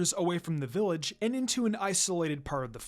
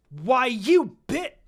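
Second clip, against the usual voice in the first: slow, louder, higher cut-off insult; seething snarl; 5 semitones higher, 7 dB louder, much slower than usual. Acted. Karin, held back by Suigetsu, snarls at Minako in rage. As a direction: spit it out in rage. Hot-headed Karin lunges.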